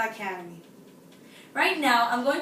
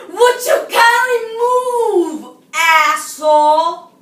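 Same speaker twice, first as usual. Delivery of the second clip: high, very loud, drawn-out yell; furious scream; 7 semitones higher, 15 dB louder, much slower than usual. Acted. Woman to the person blocking the tuna fish, enraged scream. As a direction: scream it slowly, holding each stressed word. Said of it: "and screamed".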